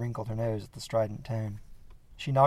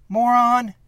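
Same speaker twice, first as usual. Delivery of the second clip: high, loud yell at the man behind the car; furious shout; 12 semitones higher, 11 dB louder than usual. Acted. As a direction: scream it in shock and anger. Man behind her bumper.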